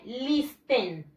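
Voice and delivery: listen slowly with a sounded T, "lis-ten"; as spelled. Shown as a demonstration. Teacher tells how it is written, listen said "lis-ten". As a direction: pronounce every written letter of listen. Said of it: "Se escribe listen".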